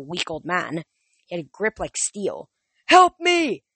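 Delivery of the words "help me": high, loud panicked scream. A narrator's reading. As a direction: scream for help. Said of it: "he screamed".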